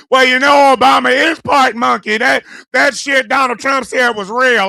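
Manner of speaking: mocking quote voice. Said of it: "people are talking about".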